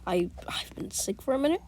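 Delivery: soft, unvoiced whisper; sick, whispering. Real, not acted. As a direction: push through feeling sick, hushed. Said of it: "I'm sick".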